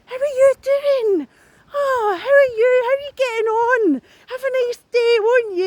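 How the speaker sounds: high, slow sugary mock greeting; mocking fake-sweet voice. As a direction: ham up a two-faced nice neighbour. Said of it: "the person that's always like".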